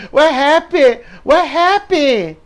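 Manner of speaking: high, loud, frantic put-on voice; mock frantic Bianca. Bianca running up to check on Jade, mock frantic and high. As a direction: play Bianca panicking loudly, high-pitched. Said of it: "Bianca runs up asking what happened".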